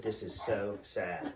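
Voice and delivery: low, slow, soft lament; mock sorrow. Dad misleading his daughter, slow mock sorrow. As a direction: feign grief, drawing the words out low.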